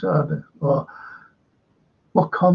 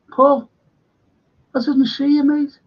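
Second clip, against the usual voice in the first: higher, louder call to a friend; excited greeting; 8 semitones higher, 4 dB louder than usual. Real, not acted. Streamer spotting a viewer in chat, excited call. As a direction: sing out a happy hello.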